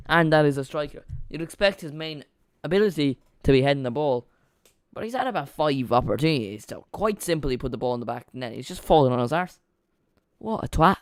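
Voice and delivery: loud frustrated rant at the striker; exasperated rant. Real, not acted. Fan raging at Giroud for falling over, loud and angry. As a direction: vent your anger at the missed headers.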